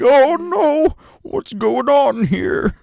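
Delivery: put-on high, loud, slow old man's alarm; panicked old man. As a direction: cry out like a frightened old codger. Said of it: "He's like".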